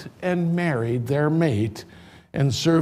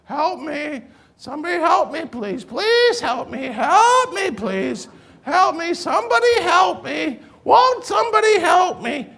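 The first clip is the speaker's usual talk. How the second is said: high, wailing pleas that swell into shouts; old woman crying for help; 11 semitones higher than usual. Retold or imitated. Rest home resident calling for help, high wailing shouts. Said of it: "next to her is this woman".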